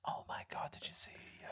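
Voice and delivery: hushed gossiping whisper. Pretend. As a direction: whisper it so she won't hear. Shushing as she's coming.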